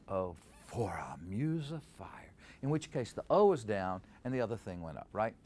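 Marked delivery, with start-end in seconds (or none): 0.1-2.3 "Oh" low and soft, rising to "for", slow; slow, soft iambic line, "for" up; purist iambic reading. Shown as a demonstration. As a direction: keep "Oh" down and lift the "for". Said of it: "be a real purist and go".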